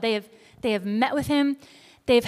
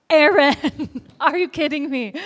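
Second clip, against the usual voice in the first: loud, raised outburst breaking into a laugh; mock-outraged scolding of a brother; angry disbelief; 4 semitones higher, 6 dB louder than usual. Moses confronts his brother over the idol, angry disbelief. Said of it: "Like, he's angry".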